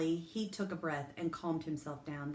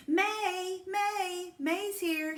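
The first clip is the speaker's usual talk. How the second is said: high excited shout through a car window; 13 semitones higher, 6 dB louder, much slower than usual. A narrator's reading. Girl shouting her own name from the car. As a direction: shout it, thrilled with herself. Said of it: "she shouted through the open window".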